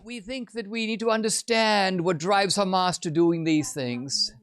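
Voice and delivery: high, smooth mock negotiator voice; mock diplomat. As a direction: play the reasonable envoy, lightly. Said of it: "Qatar is like".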